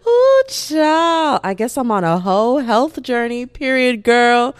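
loud, high, sassy hype; hyped up. Real, not acted. Vlogger riffing to listeners on her slip, loud and hyped. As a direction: shout it out like a preacher at a party.

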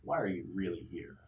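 put-on stern old interrogator. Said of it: "Blumpkin says".

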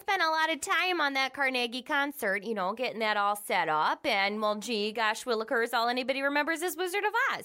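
a light Minnesota twang, grumbling; Minnesota accent. Shown as a demonstration. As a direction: keep the folksy Minnesota gripe going. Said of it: "So that would be, like, um, an example of".

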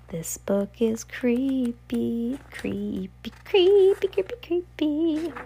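drawn-out whisper rising into a high singsong; playfully creeped out. Pretend. Reader breaks off to comment on the creepy book, in playful spooky tones. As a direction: stretch it out spookily, jumping up high.

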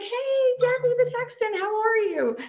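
bright high-pitched friendly hello; cheery greeter. Retold or imitated. NFL staffer spotting Debbie at the draft, chirpy high greeting. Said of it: "And she's like".

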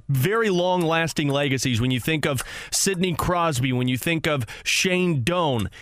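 ordinary delivery

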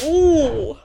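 a mock-admiring coo; impressed ooh. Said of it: "you be like".

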